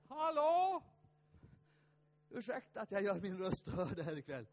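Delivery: grab the room's attention; calling out. Real, not acted. Preacher calling out to the hall for attention.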